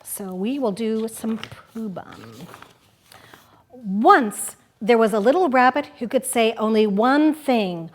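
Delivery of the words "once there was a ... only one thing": lively picture-book reading; reading to children. Acted. Host reading Pooh Bum aloud on the radio, playful storytelling. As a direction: read it like story time for kids.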